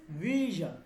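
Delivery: ভিশন as "vee-zhan", firmly said; English-style zh sound in ভিশন; zh in vision. Shown as a demonstration. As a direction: say ভিশন with a long vee and buzzed zh. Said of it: "তাহলে এইটা clear হয়েছে".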